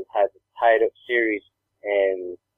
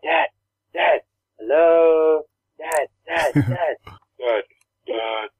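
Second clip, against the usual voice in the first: imitating a little girl pestering dad; daughter changing her voice; 2 semitones higher, much slower than usual. Dad mimics his little girl calling him from her bed, changing voices. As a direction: play a kid calling Dad in shifting voices. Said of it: "She was changing her voice?".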